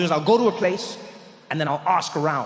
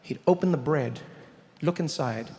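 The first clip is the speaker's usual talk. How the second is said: normal talking voice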